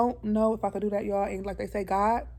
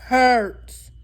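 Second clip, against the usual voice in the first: emphatic; 4 semitones higher, 8 dB louder than usual. Real, not acted. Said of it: "going natural hurts".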